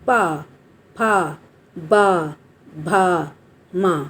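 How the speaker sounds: पा, फा, बा, भा, मा low and drawn out, one by one; labial sounds demo. Shown as a demonstration. Teacher showing lip-made letters, पा to मा slowly, separately. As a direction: bring both lips together for each of पा, फा, बा, भा, मा. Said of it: "जिनके उच्चारण में हमारे होंठ चिपकते हैं".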